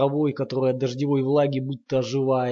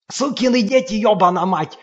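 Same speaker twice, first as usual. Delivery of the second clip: much higher and louder, raging; frenzied roar; 7 semitones higher, 7 dB louder than usual. A narrator's reading. Lomov in a frenzy behind the door, raging roar. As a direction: rage out of control, stamping. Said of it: "ревёт он в неистовстве".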